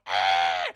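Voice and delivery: loud mock scream of panic; sudden, loud, hammed-up yell; panicked scream. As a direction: scream it, imitating a panicking crowd. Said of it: "they start fucking panicking and screaming".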